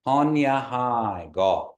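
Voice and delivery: slow, drawn-out Han ya hai ga for repeating; slow model phrase. Shown as a demonstration. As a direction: say it slowly and firmly, each syllable held. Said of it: "we'll practice this a couple of times".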